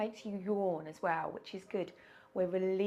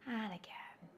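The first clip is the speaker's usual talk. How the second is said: whispering; 7 dB quieter than usual. Real, not acted. Breathing exercise, whispered cue.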